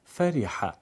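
"فریحه" said fariha with a short i; correct kasra. Shown as a demonstration. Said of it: "فرحه گفته نمیشه".

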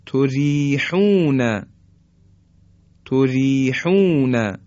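تریحون slowed down, with a thin ر; slow light r demo. Shown as a demonstration. Tajwid teacher slowly reciting تریحون for students.

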